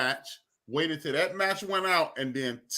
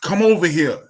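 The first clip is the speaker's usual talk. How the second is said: raised-voice maternal summons; summoning shout; 2 semitones higher, 7 dB louder than usual. Retold or imitated. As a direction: call him over sharply, voice raised. Mother calls uncle over in story.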